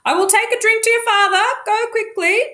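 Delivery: high, quick call to someone leaving; calling over shoulder. Acted. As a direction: toss it back as she goes. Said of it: "calling over her shoulder".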